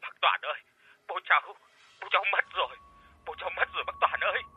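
a son sobbing out his father's death. Acted. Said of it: "khóc nức nở nói".

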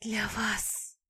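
breathy whisper. Acted. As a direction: breathe it out seductively. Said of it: "прошептала с придыханием".

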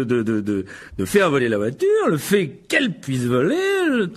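stammering, hesitant self-justification; flustered excuse-making. Acted. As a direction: talk your way out, stumbling on words. Guilty husband stammers excuses to wife. (se justifier en bafouillant)

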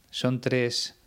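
ordinary delivery

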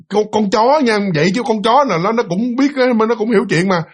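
ordinary delivery

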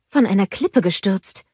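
ordinary delivery, nothing marked

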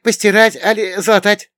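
very high, loud offer of chores; eager housemaid Afinka. A narrator's reading. (высокий, громкий голос услужливой хозяюшки)

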